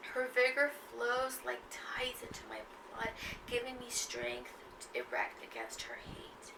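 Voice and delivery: hushed, slowed poem reading. Acted. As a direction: read the line softly, letting it linger. Reads the poem aloud.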